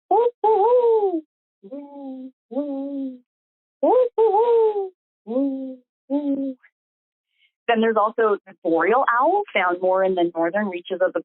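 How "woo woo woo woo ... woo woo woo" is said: high hooting woos, each trailing down and away; great horned owl hoots. Shown as a demonstration. Naturalist showing webinar guests the great horned owl, high hoots. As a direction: hoot like a great horned owl calling at night. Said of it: "So sort of".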